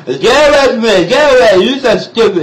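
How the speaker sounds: high, loud garbled yell; slurred mock anger. Numbed patient shooing his sister, loud and slurred. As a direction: yell it half-laughing through a numb mouth.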